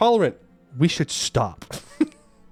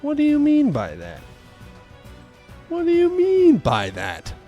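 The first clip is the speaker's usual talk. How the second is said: mock bewilderment; 10 semitones higher than usual. Mock-puzzled repeat to chat.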